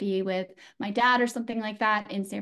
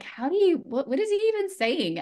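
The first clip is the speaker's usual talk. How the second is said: much higher, bewildered question; baffled kid; 9 semitones higher, 3 dB louder than usual. Retold or imitated. Young girl to her mom about the New York tape, baffled. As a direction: squeak out confused disbelief.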